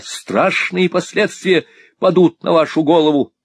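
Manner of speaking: loud, high, indignant tirade; heated, ringing denunciation; shouting in anger. A narrator's reading. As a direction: build a furious warning at full voice. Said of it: "кричал Николас, раскрасневшись от гнева".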